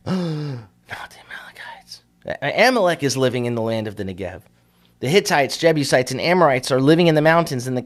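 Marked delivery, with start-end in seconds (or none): 0.0-2.0 a sharp gasp, then a slow frightened whisper; gasped fearful whisper. Acted. Preacher voicing spies gasping at the Amalekites, whispered fear. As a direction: breathe it out like a scared spy.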